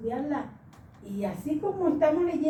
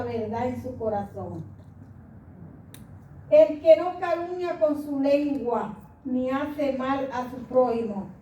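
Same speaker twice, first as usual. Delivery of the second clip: slow, louder scripture read; psalm reading; 3 semitones higher, 4 dB louder than usual. Acted. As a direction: declaim the psalm slowly. (lectura solemne del salmo, lenta)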